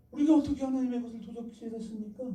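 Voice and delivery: high-pitched protest, acted out; 되묻는 항변. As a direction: push back as the accused people. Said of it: "반문". (높은 목소리로 항변하듯 되묻기)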